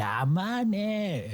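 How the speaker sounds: high put-on comedian voice; a comedian's catchphrase, voiced high; imitating Tanaka. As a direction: mimic the comic's odd call of a name. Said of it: "[name] starts imitating, uh, Tanaka from Ungirls".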